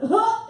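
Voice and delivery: high, loud mock huff; dismissive huh. Comic as J-Lo dismissing a man from Fresno, loud huff. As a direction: throw out a sharp, loud huh. Imitates singer's reaction before walking off.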